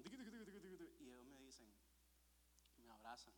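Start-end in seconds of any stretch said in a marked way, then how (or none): 0.0-1.0 soft, quick fluttering heart noise; imitated racing heartbeat. Nervous inside facing his parents, imitated racing heartbeat. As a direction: rattle it off softly like a nervous pulse. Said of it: "por dentro con el corazón".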